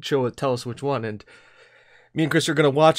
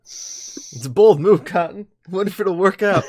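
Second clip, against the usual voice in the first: Cotton from Dodgeball; 5 semitones higher, 6 dB louder than usual. Retold or imitated. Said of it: "basically Cotton from Dodgeball".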